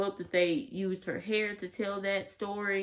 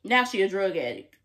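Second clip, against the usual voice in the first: ordinary delivery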